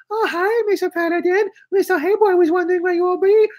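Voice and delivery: put-on very high, squeaky caricatured laundry girl; radio girlfriend voice. Laundry girl greeting her boyfriend on the radio, very high squeak. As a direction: shoot way up into a falsetto for the girlfriend. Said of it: "she talks like it too".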